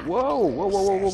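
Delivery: high, rapid startled woahs. Real, not acted. Gamer yelps woah at sudden attackers, startled. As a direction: caught off guard by a crowd of enemies.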